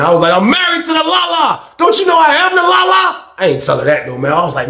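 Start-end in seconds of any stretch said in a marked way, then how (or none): 0.3-3.1 high-pitched, hammed-up declaration; mock impassioned outburst. Husband's imagined declaration to his wife, mock impassioned. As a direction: protest with comic over-the-top devotion.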